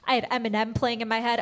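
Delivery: normal talking voice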